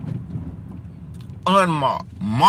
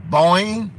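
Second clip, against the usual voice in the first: boing sound effect; 4 semitones higher, 4 dB louder than usual. Retold or imitated. Imitates jumping over fence.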